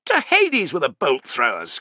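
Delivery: high, loud outburst of scorn; angry cry. A narrator's reading. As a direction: dismiss it with an impatient shout. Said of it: "cried Tubero".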